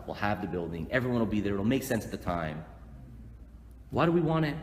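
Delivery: ordinary delivery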